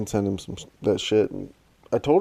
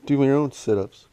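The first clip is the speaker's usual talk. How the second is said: louder and higher, a hammed-up challenge; mock tough-guy retort; 6 semitones higher, 4 dB louder than usual. Co-host answers the imagined gym bro with mock aggression. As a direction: bark it back like picking a fight.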